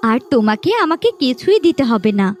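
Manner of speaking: ordinary talk, nothing marked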